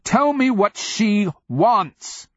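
very high, loud, drawn-out demand; exasperated yell; irritated cry. A narrator's reading. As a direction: shout each word slowly, exasperated. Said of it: "cried out, his wonder giving away to irritation".

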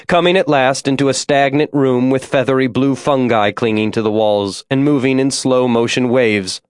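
usual speaking voice

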